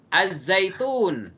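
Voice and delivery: slow model reading of "Az-zaitun"; silent alif lam. Shown as a demonstration. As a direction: stretch "Az-zaitun" straight into a long z. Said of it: "alif lamnya nggak kebaca".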